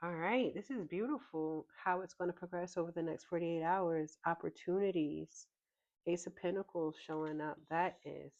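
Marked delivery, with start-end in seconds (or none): none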